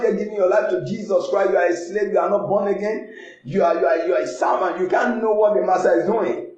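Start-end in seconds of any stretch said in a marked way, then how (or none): none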